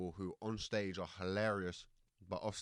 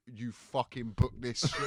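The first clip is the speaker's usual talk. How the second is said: slow, louder mock scolding; arguing Polish mum; 3 semitones higher, 6 dB louder, slower than usual. Comedian imitating the mum arguing next door, mock angry shout. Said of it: "this Polish family arguing ... this mum going".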